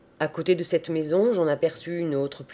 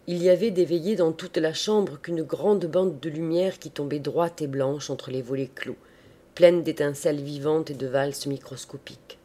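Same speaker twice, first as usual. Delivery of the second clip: plain reading voice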